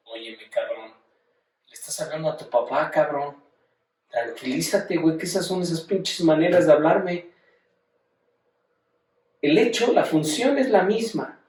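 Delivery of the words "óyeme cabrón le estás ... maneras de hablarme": lightly voiced father telling his son off; scolding father. Teacher voicing a father who scolds his rude son, mildly indignant.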